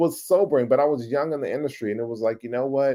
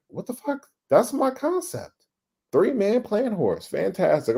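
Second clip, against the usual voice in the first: shocked disbelief; 7 semitones higher than usual. Retold or imitated. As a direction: replay the moment he saw his own concept. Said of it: "And I'm like".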